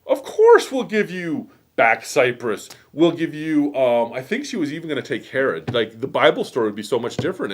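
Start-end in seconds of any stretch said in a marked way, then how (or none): 0.1-3.7 high, louder, slowed gushing; smitten Mark Antony. Retold or imitated. Teacher voices Mark Antony promising Cleopatra land, high gushing delight. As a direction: melt into an eager yes for her. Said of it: "and he's like".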